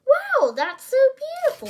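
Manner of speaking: high, loud, quick gush; excited girlish admiration; delighted awe. Acted. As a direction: gush over it in wide-eyed delight. Lucky's eyes lit up at bracelet.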